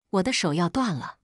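ordinary delivery, nothing marked

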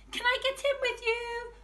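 very high little-one voice; high tiny kid asking; toddler's voice. Acted. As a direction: ask like a tiny child. Toddler asks.

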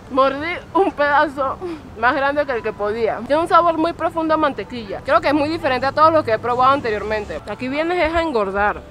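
talking while chewing; muffled behind hand; mouth full. Real, not acted. Seen 0: Bit churro before; hand covers full, chewing mouth throughout.

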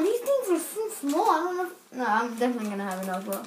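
ordinary talk

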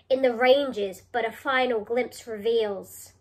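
ordinary talk

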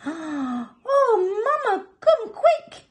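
very high, loud little voice; alarmed child's cry. Acted. Baby baboon calls mother, high alarmed cry. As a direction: call for Mama in a panicked squeal. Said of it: "cried Baby Baboon".

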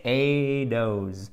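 slow "a dose", t turned to d; slow syllable demo. Shown as a demonstration. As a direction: stretch out "a dose". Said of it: "prononcé comme un de".

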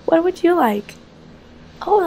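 ordinary talk, nothing marked

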